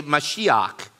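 Mashiach with a throaty final ch, said slowly; Hebrew pronunciation. Shown as a demonstration. Pastor teaching the Hebrew word, Mashiach said slowly. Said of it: "In Hebrew, we say".

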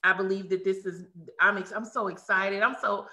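ordinary talk

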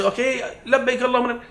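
mock offhand, tossed-off and fast; careless rushed talbiyah. Pilgrim at the border, rushing the talbiyah carelessly. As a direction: rattle it off like it means nothing. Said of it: "No, I want to get ready".

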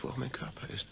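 trailing off into a whisper. Acted. Man whispering his doubts to himself. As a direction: voice drops to a hush. Inner monologue, self-doubt.

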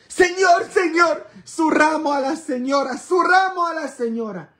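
high, loud vendor calling out; imitating street vendor's shout. Palm seller shouting at a couple arriving for mass, loud and high. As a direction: bellow it like a seller outside church. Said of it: "les está gritando a todo volumen".

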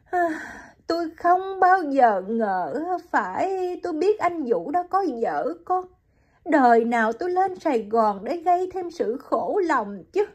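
high woman's voice, full of regret; Hiền tells Liễu she never knew Vũ was married, high and sorrowful; young woman lamenting. Acted.

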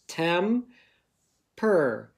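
temper as tem, pause, per; split into syllables. Shown as a demonstration. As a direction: break temper into its two syllables with a gap. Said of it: "What's my parts?".

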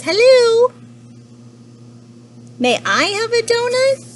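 very high, loud squeaky creature; cute little lemur. Acted. Little lemur asks panda for a donut, high and eager. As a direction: pipe up in a tiny, high voice.

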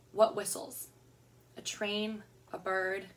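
usual speaking voice

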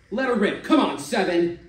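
put-on kid's first roll, shouted hope; urging the dice. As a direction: pump yourself up at the roll. Narrator urges his own roll.

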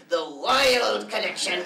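slow, grand, drawn out; dramatic announcement. Acted. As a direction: announce it like a spooky title. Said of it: "Why are you saying it like that?".

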